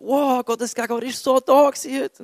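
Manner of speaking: high, put-on awestruck voice; mock rapture, pitched well up; gushing awe. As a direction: imitate an overwhelmed churchgoer gushing. Imitates awed worshippers after service.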